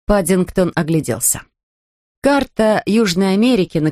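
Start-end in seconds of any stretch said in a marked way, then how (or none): none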